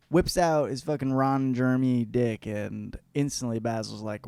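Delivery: normal talking voice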